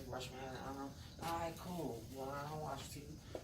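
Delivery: normal talking voice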